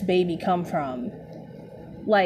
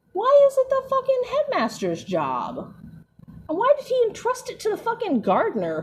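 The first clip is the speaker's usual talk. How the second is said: much higher, incredulous outburst; outraged disbelief; 12 semitones higher than usual. Real, not acted. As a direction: squeak it out in disbelief. Outraged rant about headmaster.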